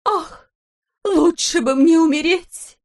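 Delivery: slow, high melodramatic lament; wailing countess. A narrator's reading. Countess on stage wailing about her fate, melodramatic. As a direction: ham up the tragic countess. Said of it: "не унималась графиня".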